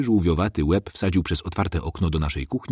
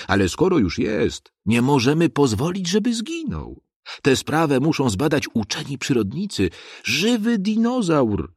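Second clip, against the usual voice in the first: raised, earnest lecturing voice; teacher's speech; 7 semitones higher than usual. A narrator's reading. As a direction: address the crowd like a lesson. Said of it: "powiedział tak".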